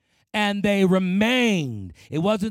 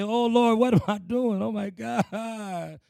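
higher, drawn-out mock lament, fading at the end; tearful plea; 4 semitones higher, slower than usual. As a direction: act out crying out to God in remorse. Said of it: "we need to cry some real tears".

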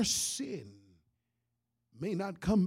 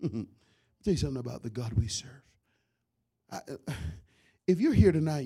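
normal talking voice

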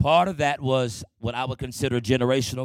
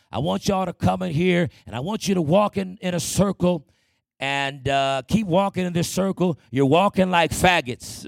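higher, louder harsh order; father's command; 5 semitones higher, 5 dB louder than usual. Retold or imitated. Father ordering the kids to walk in a circle, higher, louder, harsh. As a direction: order them around like the abusive father. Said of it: "he said".

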